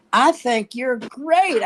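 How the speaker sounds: high, drawn-out gushing fan; girl in the audience. Retold or imitated. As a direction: imitate an adoring girl, slowly.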